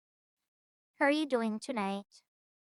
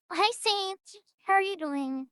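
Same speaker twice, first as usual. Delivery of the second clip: usual speaking voice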